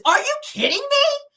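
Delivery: very high, loud squeal; shrieky mock disbelief; mock incredulous outrage. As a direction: imitate an outraged daughter, voice shooting way up. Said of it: "So Lexi says".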